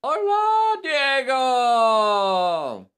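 high, loud, drawn-out holler; calling out. Pretend. Host greeting a viewer on his first live, loud hail. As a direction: hail a friend with long loud vowels.

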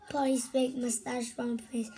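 ordinary delivery

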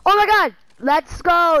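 loud, high cries of excitement; thrilled shouting. Real, not acted. Gamer celebrating a lucky find, thrilled shouting. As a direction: yell it out in celebration.

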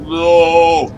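sudden high frightened no; scared cry. Real, not acted. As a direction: yelp in fright. Startled by steam in game.

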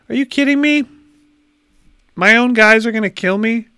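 high, loud disbelief: "Are you kidding me?"; can't believe his own guys turned on him; shocked disbelief. Real, not acted. Own fleet turns hostile.

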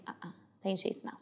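breathy whispered doubt; whispered scoff at the teacher; retold whisper. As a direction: whisper it like a sceptical student to yourself. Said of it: "eu fui uma dessas que falei".

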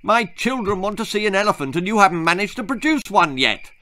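bullying boss. Acted. Rich man berates guide.

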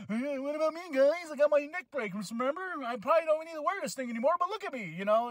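mock a jealous guy begging for attention; whiny jealous wrestler. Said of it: "He's just like".

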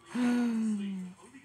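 high gasp. Real, not acted. Suddenly next to a lion.

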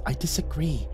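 high, hushed sprite objection. Acted. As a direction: speak quietly and high as a tiny sprite. Sprite speaks in dialogue.

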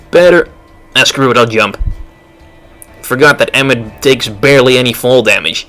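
normal talking voice

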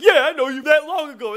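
high imitation of the guard; mock-hostile guard. Guard answering the customer's unspoken doubt, mock hostility. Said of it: "And the guy's like".

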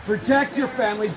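usual speaking voice

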